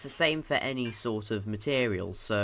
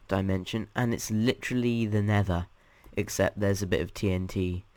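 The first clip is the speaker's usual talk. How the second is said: normal talking voice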